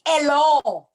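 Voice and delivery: Hello! said as "ello" with no H, loud, falling; patois hello. Shown as a demonstration. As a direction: call Hello! as "ello", no H at the start. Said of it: "we leave the H off".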